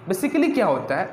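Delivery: ordinary talk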